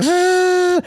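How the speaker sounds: high mock cry of effort; mock frustrated ah. Boy at the belt sander, mock frustrated cry. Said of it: "he was like".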